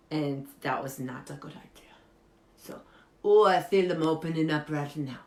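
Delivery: usual speaking voice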